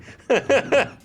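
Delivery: high, loud burst of giggles; real high-pitched laughing fit; giggling fit. Real, not acted. Tickled by the exact percentage.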